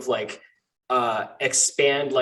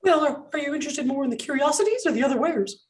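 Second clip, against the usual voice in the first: very high-pitched little shopkeeper; squeaky gnome; 14 semitones higher than usual. Acted. Gnome shopkeeper asking a customer's interest, high squeaky voice. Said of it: "a high-pitched voice squeak up".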